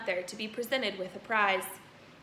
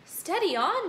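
put-on nervous boy; 9 semitones higher, 5 dB louder than usual. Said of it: "the boy said".

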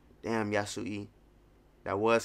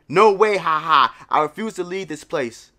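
defiant laughing; 5 semitones higher, 8 dB louder than usual. Acted. Voicing defiant laughing townsfolk.

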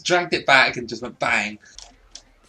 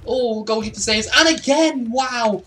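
excited cry; 10 semitones higher, 3 dB louder than usual. Real, not acted. Reacting to a double save.

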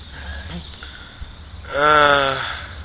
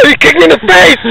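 angry yell; 7 dB louder than usual. Real, not acted. Yelled after being kicked.

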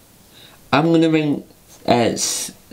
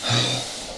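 weary sigh; 7 dB quieter than usual. Acted. Fed-up toy owner.

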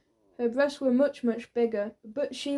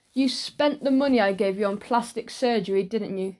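ordinary delivery, nothing marked

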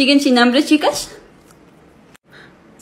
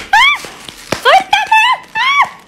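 shrill high fight screams; 4 dB louder, much slower than usual. Acted. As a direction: scream while being grabbed.

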